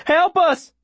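high desperate yell; shouting for help. A narrator's reading. Boy carrying a wounded girl into town, desperate shout. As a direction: cry out high for anyone to hear. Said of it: "I'm shouting as we come to it".

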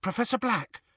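high, imploring call; high-pitched polite appeal; pleading girl. A narrator's reading. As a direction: address the professor beseechingly. Hermione pleads with the portrait.

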